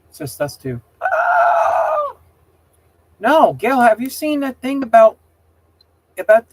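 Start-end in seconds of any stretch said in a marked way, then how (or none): 1.0-2.2 sudden high loud yelp; startled shriek. Real, not acted. Streamer startled as a friend joins, shrieking. 3.2-6.5 higher and louder, eager; excited greeting. Real, not acted. Streamer greeting friend Gale, excited and loud.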